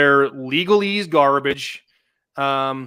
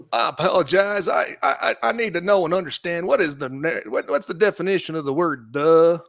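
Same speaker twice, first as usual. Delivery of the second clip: lightly voiced Bill Clinton; mild take on a politician ducking the question; Bill Clinton impression. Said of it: "That's my best Bill Clinton impersonation".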